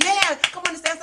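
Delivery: lightly voiced crowd yelling come on; cheering crowd. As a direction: egg her on like the audience. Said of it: "the audience went".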